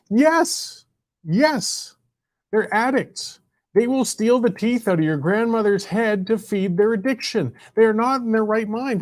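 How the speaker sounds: high-pitched, insistent argument; heated, emphatic insistence; impassioned rebuttal. Real, not acted. Heated rebuttal of a dismissive tweet.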